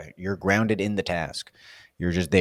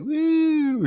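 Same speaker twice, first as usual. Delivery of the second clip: a very high, held woo; floaty woo. Retold or imitated. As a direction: float up like a carefree surfer. Mimics carefree floaty mind.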